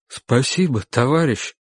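a touch of a tremble; faltering voice. A narrator's reading. Cornered man thanking his rescuer, faltering slightly. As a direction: thank him with an understated falter. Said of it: "сказал человек дрогнувшим голосом".

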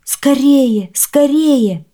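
high, loud call to hurry; urgent child's shout; urgent cry. A narrator's reading. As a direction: beg to be pulled out. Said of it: "опять закричал пирог".

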